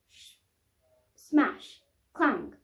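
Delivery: usual speaking voice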